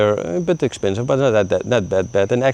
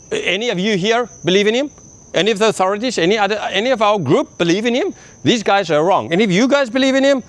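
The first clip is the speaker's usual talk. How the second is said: challenging Pharisee; 11 semitones higher than usual. Retold or imitated.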